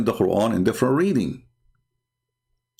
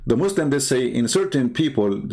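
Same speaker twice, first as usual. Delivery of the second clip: usual speaking voice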